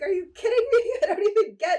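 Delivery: high, laughing outrage; laughing disbelief. Retold or imitated. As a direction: squeak it out through laughter. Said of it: "and I was like".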